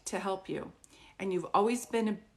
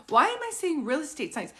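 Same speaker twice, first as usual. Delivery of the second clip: puzzled wondering; 6 semitones higher, 5 dB louder than usual. Retold or imitated. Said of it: "That's why I was like".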